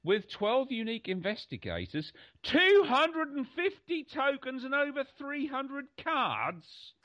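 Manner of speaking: reading the blurb in a rising, drawn-out sales voice; slow, high mock-grand quote of the box text; mock reading aloud. Friend mocking the game description to the host, mock-grand reading. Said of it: "and I quote".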